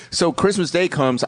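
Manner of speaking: ordinary talk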